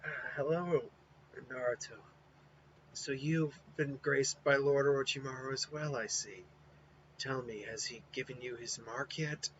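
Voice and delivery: soft and weary, fading out; frail Kimimaro. Acted. Sickly Kimimaro greeting Naruto, quiet and weary. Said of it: "And it's like".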